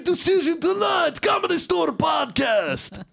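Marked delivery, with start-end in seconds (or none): 1.2-3.0 high drawn-out shout, much higher pitch; shouted promo. Acted. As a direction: belt out the podcast promo, slow and high. Performs a promo, then calls cut.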